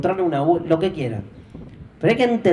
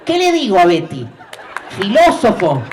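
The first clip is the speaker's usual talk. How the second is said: mom's outburst; 4 semitones higher, 5 dB louder than usual. Retold or imitated.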